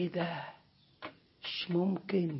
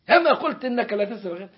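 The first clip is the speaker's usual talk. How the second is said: rattled off fast and a bit louder; brisk, faster telling-off; quick reproach; 4 semitones higher, 4 dB louder, much faster than usual. Acted. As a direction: hurry the reminder impatiently. God reminds the servant.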